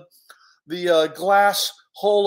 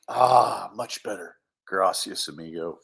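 relieved sigh; 11 semitones lower than usual. Acted. Bear freed from tight leash, relieved. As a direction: let out relief, settle into low bear voice.

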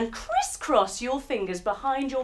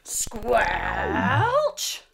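louder drawn-out squelch; squelch sound; 4 dB louder than usual. Acted. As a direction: squelch it wetly. Another squelchy step.

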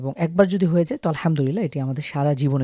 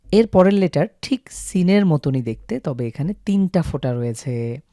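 normal talking voice

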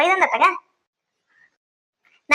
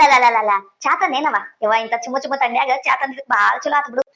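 usual speaking voice

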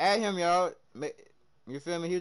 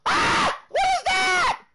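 sudden high shout of surprise; startled shout; 10 dB louder, much slower than usual. Real, not acted. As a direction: yelp as if caught off guard. Startled again by game play.

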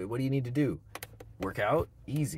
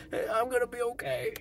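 shot man repeating; 13 semitones higher than usual. Retold or imitated. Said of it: "the guy in the back is".

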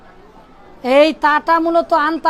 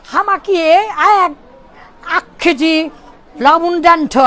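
put-on halting British or American-accented Bengali; foreigner's Bengali accent; slower than usual. Westerner shopping for salt in Dhaka, English-accented Bengali. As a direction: mangle the Bengali like a Western learner. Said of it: "যারা American বাংলা শিখেছে".

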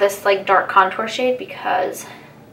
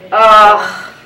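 laughing groan; 8 dB louder than usual. Real, not acted. Groaning at own slowness.

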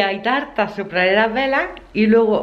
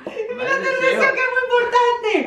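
high laughing shout; laughing excited call; 13 semitones higher than usual. Real, not acted. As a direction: cry out through laughter about the wish. Grita riendo ante la tarta. (grito agudo entre risas)

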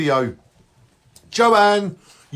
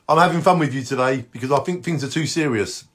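ordinary talk

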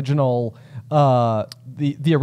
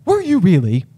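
acted proud dad, pitched up; delighted parent; 5 semitones higher, 3 dB louder than usual. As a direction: voice lifting in impressed delight. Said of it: "responds with this exact candor".

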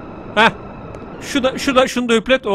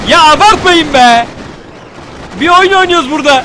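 high, loud exasperated complaint; loud protest; 6 semitones higher, 6 dB louder than usual. Real, not acted. Gamer telling the game to stop overdoing it, exasperated shout. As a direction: protest loudly, stretching the first words.

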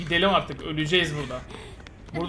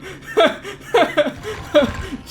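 high, breathless laughter close to tears; laughing till it hurts; 11 semitones higher than usual. Real, not acted. Streamer laughing at the Russians' comment, near-tearful laughter. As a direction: laugh until you can barely breathe.

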